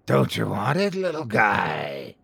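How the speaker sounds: a hint of guttural cheeriness; sickly cheery creature, slowing down; guttural cheery monster. A narrator's reading. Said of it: "A guttural voice spat out".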